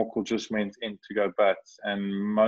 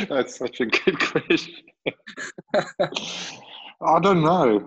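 laughing; 7 semitones higher than usual. Real, not acted. Laughing at a cheeky question.

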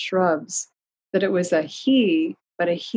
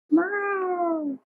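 plaintive meow; 12 semitones higher than usual. A narrator's reading. Cat calling from behind the trio, plaintive meow. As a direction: mew like a lost little cat. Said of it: "came a plaintive cry".

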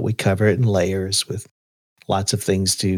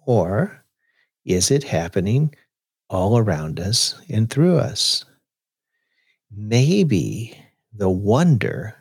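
poem reading. Shown as a demonstration. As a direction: read it like a thoughtful poem. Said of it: "I'm gonna read you a poem on wonder".

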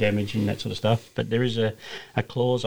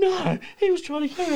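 mock frightened Vaughn. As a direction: whine it like a shaken mate. Vaughn insists he was targeted.

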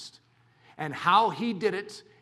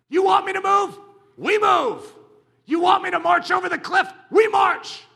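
drill sergeant; 12 semitones higher, 10 dB louder than usual. Acted. Commander and soldier exchange.

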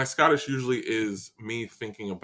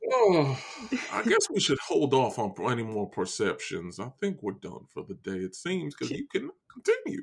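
Southern gentleman; 5 dB quieter than usual. Pretend. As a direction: wind down like a put-upon Southern gentleman. Keeps up Foghorn voice.

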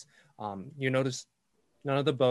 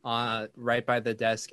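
ordinary talk

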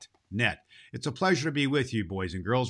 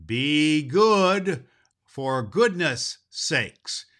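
Santa's catchphrase; 3 semitones higher, 7 dB louder, much slower than usual. Acted. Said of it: "Santa Claus says".

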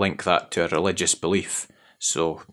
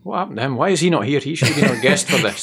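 mock indignant; 6 semitones higher, faster than usual. Real, not acted. Jokey mock outrage.

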